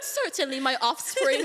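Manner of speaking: amused, hammed-up British English; British accent. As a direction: put on British formality, half laughing. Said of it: "pero British accent siya".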